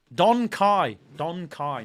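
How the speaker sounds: Don Kai split apart, not donkey. Shown as a demonstration. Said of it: "not donkey".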